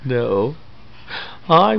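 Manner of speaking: ordinary talk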